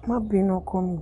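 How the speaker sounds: normal talking voice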